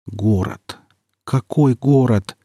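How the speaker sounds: slow, numb-lipped murmur; numb lips. Acted. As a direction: mouth it through numb lips. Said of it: "шевелил Никита онемевшими губами".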